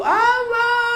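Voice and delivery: high, sung-out mock wail; widow's theatrical wail at the grave. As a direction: cry the name out like a grieving wife, hammed up. Voices wailing widow at the grave.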